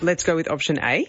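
rising tune at the end; "option A" ending on a rise on "A"; upward inflection. Shown as a demonstration. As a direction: lift "A" up like a question. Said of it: "So upward inflection is like".

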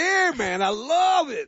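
put-on high, drawn-out Texas accent. Host showing off his favourite Texas accent to co-hosts. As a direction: lay on a Texan drawl. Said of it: "the Texas".

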